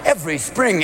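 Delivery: usual speaking voice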